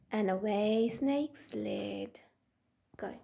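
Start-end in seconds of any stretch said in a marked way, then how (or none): none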